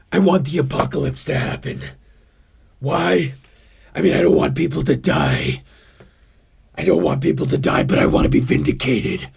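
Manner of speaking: low, brooding survivalist voice talking himself up; off-the-grid prepper. Acted. Prepper character in his acting class, low and brooding. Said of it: "I have another guy. He's an off-the-grid guy".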